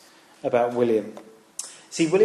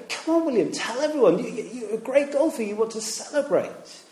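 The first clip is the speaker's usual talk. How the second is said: much higher, eager cheering; excited urging; 7 semitones higher than usual. Retold or imitated. Teacher retelling the school egging on William, excited high urging. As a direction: egg him on, thrilled for him. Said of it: "We were all going".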